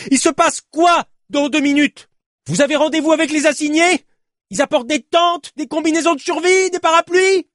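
high, exasperated tirade; sarcastic shouting. Acted. Despairing cyclist challenging the journalist's promise, sarcastic shout. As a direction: fire mocking questions at him, shouting.

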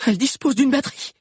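high, urgent puffed-out reply; breathless. A narrator's reading. Lunella answering Hakan while running, breathless. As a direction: puff out the answer mid-sprint. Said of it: "souffla encore Lunella".

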